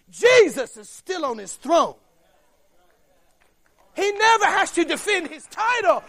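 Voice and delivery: fiery preaching. Acted. Preacher exalting God over football titles, rousing loud preaching. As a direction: preach it with building fervour.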